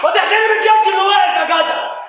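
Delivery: loud high scolding; father yelling at his son to show respect; angry shout. Acted. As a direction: tell him off hard. Scolding loudly.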